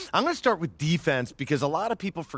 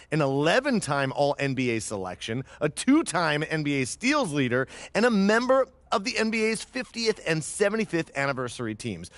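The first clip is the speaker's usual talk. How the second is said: usual speaking voice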